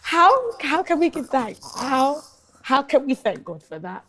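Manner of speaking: high, loud disbelief; amazed laughing outrage; incredulous. Real, not acted. Incredulous retelling of arson testimony.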